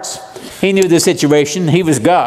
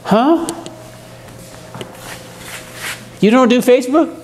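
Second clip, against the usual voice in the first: playful disbelief, pitched up; mock surprise; 7 semitones higher, 4 dB quieter than usual. Pastor teasing the congregation about skipping Facebook, mock surprise. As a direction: tease them with fake astonishment.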